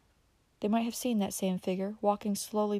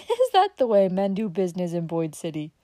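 louder, amused teasing; laughing; 7 dB louder than usual. Acted. Dick laughing at Uncle Bobby's idea of security, teasing. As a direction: ask it through a laugh. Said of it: "Dick laughed at him".

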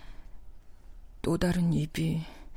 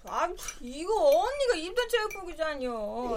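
higher whiny pleading; whining younger daughter; 9 semitones higher than usual. Acted. Younger daughter whines for gym clothes.

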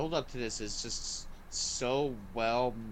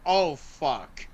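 a louder, hushed gasp of dread; stunned oh fuck; 3 semitones higher, 7 dB louder than usual. Retold or imitated. Viewer seeing the xenomorph emerge, stunned and louder. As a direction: let it drop out of you in shock. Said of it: "you're like".

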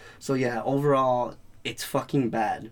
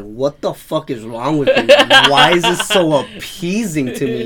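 laughing disbelief; 3 semitones higher, 11 dB louder, slower than usual. Retold or imitated. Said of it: "it's like".

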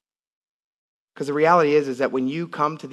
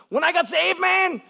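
much higher put-on voice of a tiny laid-back guy; imitating Pancho Juarez. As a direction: act like a small excited Mexican pastor, high. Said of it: "I'm just acting like him".

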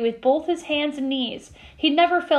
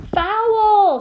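high, loud drawn-out shout; crowd scream; 9 semitones higher, 6 dB louder than usual. A narrator's reading. Gryffindors protest the block from the stands, screamed. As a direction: holler it like outraged fans. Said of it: "screamed the Gryffindors".

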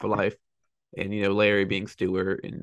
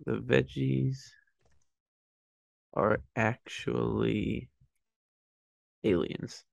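slow drawn-out read; 4 dB quieter, much slower than usual. Acted. Reading title slowly.

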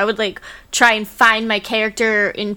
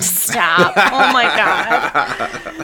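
laughing; 3 semitones lower, much slower than usual. Real, not acted. Bashful at the self-praise.

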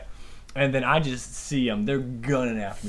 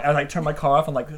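usual speaking voice

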